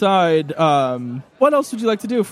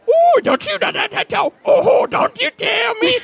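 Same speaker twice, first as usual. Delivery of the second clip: high, shrieking squeals of a scuffle; high shouted squabble on the floor; squabbling old men. Acted. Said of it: "and they're going".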